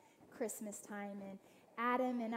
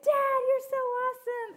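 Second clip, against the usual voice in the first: put-on much higher and much louder, gushing kid voice; 14 dB louder than usual. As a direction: squeal it like thrilled kids. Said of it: "They're like".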